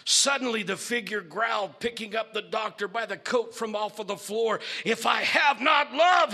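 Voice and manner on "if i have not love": higher, loud roar; bellowing creature. Acted. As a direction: bellow it with fury. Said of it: "bellowed the creature".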